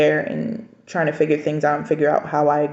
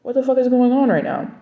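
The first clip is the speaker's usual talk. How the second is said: wife left alone after husband walks out, stunned and raised; stunned outburst; 6 semitones higher than usual. Retold or imitated. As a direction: pitch jumps up in shocked disbelief. Walked out, shocked disbelief.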